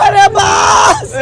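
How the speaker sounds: high, drawn-out pleading yell; frightened cry. Real, not acted. On the ride, begging his friends to make it stop, scared.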